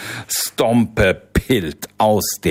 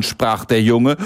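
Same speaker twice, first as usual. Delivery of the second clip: ordinary delivery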